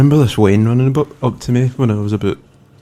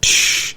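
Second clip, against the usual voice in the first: smash noise. Retold or imitated. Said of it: "It was just like".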